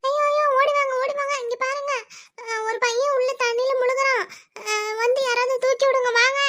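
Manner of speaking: high, loud, frantic screaming for help; panicked cry for help. Acted. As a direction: shout desperately for anyone to come. Woman sees a drowning boy. (பதற்றத்துடன் உரக்க உதவிக்கு அலறல்)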